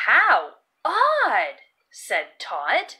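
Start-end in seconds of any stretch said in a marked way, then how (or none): 0.1-1.6 higher, louder puzzled exclaim; bright wondering exclamation; surprised "how odd". Acted. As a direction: marvel at the odd sight. Todd remarks on odd sight.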